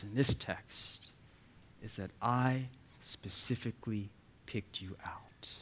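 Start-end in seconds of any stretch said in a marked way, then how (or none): 2.2-5.5 hushed, "I", "specifically", "picked", "you", "out" each set apart; softly weight every word of "I specifically picked you out"; deliberate word-by-word emphasis. Retold or imitated. God telling believers they are chosen, hushed deliberate emphasis. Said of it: "what God is saying to us".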